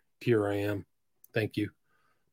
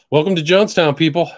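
sarcastic loud welcome; 6 semitones higher, 11 dB louder than usual. Real, not acted.

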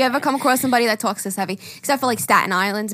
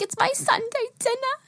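imitating mom; 13 semitones higher, 5 dB quieter than usual. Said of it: "She'll be like".

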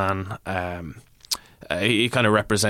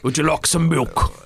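put-on higher-pitched North Side Dublin accent; 7 semitones higher, much slower than usual. Milkman offering milk to the lads, North Side accent. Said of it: "that's pretty North Side there".

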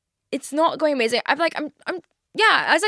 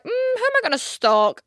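a much higher, sly, mischievous voice; mock scheming; 11 semitones higher than usual. Imitating herself hunting for someone new to stalk, sly and mischievous. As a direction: purr it like a plotting cartoon villain. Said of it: "so I'm like".

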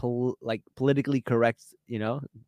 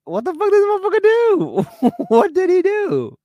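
much higher, fast, stunned outrage; shocked outburst; 5 dB louder than usual. Retold or imitated. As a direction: shriek it in disbelief. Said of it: "So I'm like".

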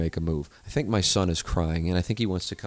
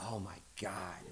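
whispered mock amazement; 16 dB quieter than usual.

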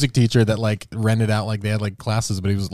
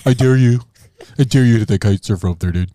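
gruff, louder goading voice; gruff tinny action figure; 5 dB louder than usual. Pretend. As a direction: bark it like a toy hero. Voicing whales daring each other.

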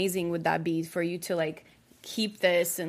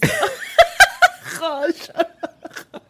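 shrieking burst of laughter; loud high laugh; 8 dB louder than usual. Real, not acted. Group teasing.

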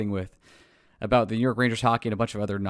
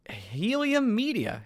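drawn-out name; 10 semitones higher, much slower than usual. Shown as a demonstration. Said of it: "Hey, welcome".

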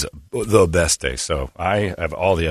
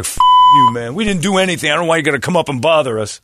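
mock angry shout; 8 semitones higher, 4 dB louder than usual. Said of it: "the guys in the back are like".

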